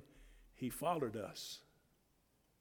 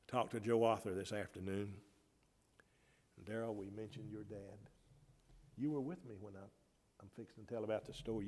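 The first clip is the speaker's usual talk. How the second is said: ordinary delivery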